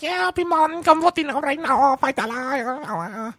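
high shrill mom calling; high mocking mother call. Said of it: "And the woman's like".

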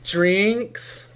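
high, questioning tipsy call; imitating drunk self. Retelling herself at the bar last night, mock drunk question. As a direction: lightly mimic a drunk asking at the bar. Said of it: "I was at the bar and I was talking".

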